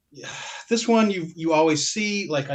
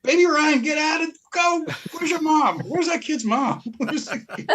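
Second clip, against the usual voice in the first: hammed-up shout, pitched way up, scolding a kid; mock yell; 13 semitones higher than usual. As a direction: holler at the kid on the cover, all in fun. Jokingly yelling at kid on cover.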